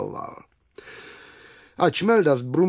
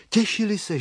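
ordinary delivery, nothing marked

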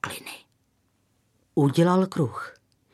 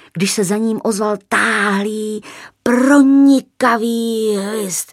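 alarmed narration; 4 semitones higher, 7 dB louder than usual. A narrator's reading. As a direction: stretch the words like the piercing whistle. Narrating a sudden piercing whistle. (táhle, hlasitě a vzrušeně)

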